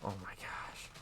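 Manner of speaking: breathy whispered oh my gosh; whispering. Real, not acted. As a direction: whisper it under your breath. Whispered reaction while playing.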